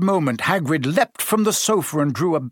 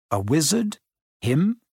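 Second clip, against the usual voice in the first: usual speaking voice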